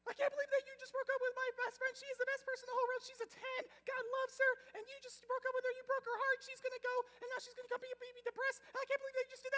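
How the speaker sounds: very high, loud, rapid-fire squeaky tirade; mock confrontational rant. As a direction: play an angry best friend up in his face. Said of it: "she's up in his face".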